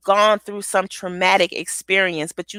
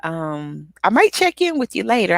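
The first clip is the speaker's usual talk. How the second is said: usual speaking voice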